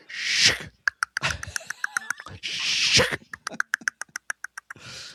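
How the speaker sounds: whirring, clicking mouth noise of a dial spinning back; rotary dial sound. Shown as a demonstration. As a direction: make a rotary phone dial rolling back slowly. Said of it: "here's my impression of a rotary phone".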